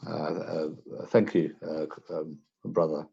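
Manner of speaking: low, hesitant, stumbling thanks; awkward thanks. Acted. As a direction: fumble for how to address him. Player acts awkward customer thanking shopkeeper.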